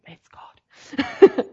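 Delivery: whispered aside; hushed, voiceless confiding; secret thought. Retold or imitated. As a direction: whisper it as a private, knowing thought. Retold private thought to herself.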